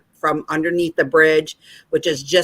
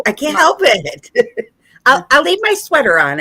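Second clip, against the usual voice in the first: giggly tease; 9 semitones higher, 4 dB louder than usual. Real, not acted. Teasing about stripping on stream.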